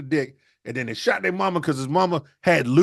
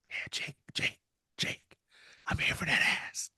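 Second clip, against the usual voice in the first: hushed, breathy creeping whisper; creepy dad whisper; 10 dB quieter than usual. Acted. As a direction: whisper it like a predator at the bedside. Dad sneaking in on son.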